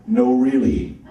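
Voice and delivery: very low, slow butler voice; slow, deep English butler; Alfred the butler. Acted. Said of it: "he did sound like the butler from Batman".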